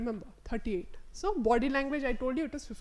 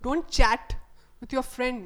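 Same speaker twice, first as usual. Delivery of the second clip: Don't loud and heavy, rest falling off; strict order, all the weight on Don't; stress on don't; 7 dB louder, slower than usual. Shown as a demonstration. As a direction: lean hard on Don't. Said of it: "don't కి stress వేస్తున్నాం".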